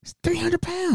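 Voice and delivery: comically intimidated, voice way up high; acted-out high fluster; flustered stammering. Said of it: "I'm stuttering 'cause I'm like".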